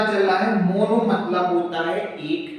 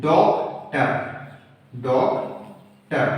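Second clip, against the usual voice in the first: "Doctor" as डॉक, pause, टर, the second time slower; split in two syllables; 5 semitones lower, much slower than usual. Shown as a demonstration. Teacher showing a two-syllable example, "doctor" broken in half. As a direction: break "doctor" into two separate beats. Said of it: "उच्चारण हम दो tone में कर पाते हैं".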